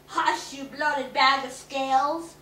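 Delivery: slow, loud rebuke; the witch silencing the lizard; angry rebuke. A narrator's reading.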